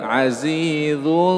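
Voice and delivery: Azizun slowly, lips rounded then zun thinned; thin ikhfa. Shown as a demonstration. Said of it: "jangan dibaca tebal". (azizun ditipiskan)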